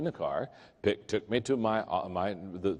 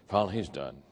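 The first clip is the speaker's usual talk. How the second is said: ordinary talk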